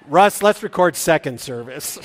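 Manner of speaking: usual speaking voice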